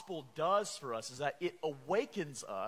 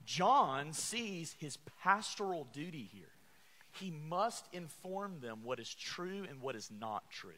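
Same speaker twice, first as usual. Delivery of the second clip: usual speaking voice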